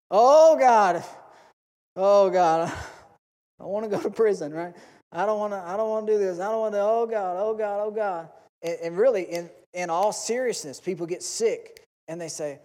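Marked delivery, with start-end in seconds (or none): 0.1-8.3 loud, higher mock wail of oh God; hammed-up desperate cry. A person facing prison begging God, hammed-up loud wailing. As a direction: overplay the panic for laughs.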